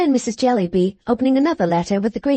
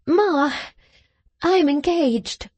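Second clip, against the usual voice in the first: tearful confession to her mother; crying; 5 semitones higher, slower than usual. A narrator's reading. Daughter tearfully tells mother she is engaged. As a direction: voice catching with tears. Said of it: "and crying heartily".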